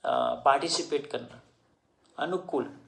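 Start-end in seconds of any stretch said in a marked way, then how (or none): none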